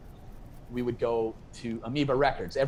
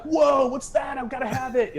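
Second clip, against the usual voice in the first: high, loud imitation of a thrilled fan; mock awed excitement; 12 semitones higher, 3 dB louder than usual. Record fan shown a rare album, acted high-pitched excitement. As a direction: leap up high with eager wonder.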